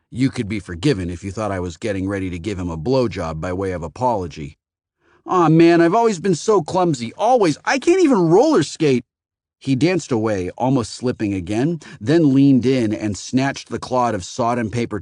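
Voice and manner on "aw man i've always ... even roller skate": much higher, louder anxious babble; nervous babbling. A narrator's reading. Teen babbles apologies while wiping the man's pants, nervous.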